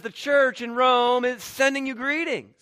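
hearty, projected quoted greeting; calling out greetings. Acted. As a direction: send the greeting out loud and high. Acting out Peter sending greetings.

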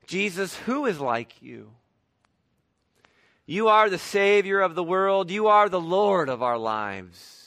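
measured, rhythmic prayer litany; public prayer. Acted. Pastor praying aloud for the congregation, reverent. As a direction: lead the room in prayer, steady.

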